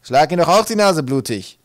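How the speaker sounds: loud, high, rough-tempered father giving orders; shouted order. A narrator's reading. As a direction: shout it as a command to the son. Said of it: "erteilte Tuntum ihm Ratschläge und Befehle". (laut gebrüllter Befehl des Vaters)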